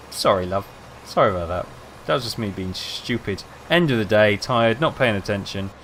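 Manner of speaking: usual speaking voice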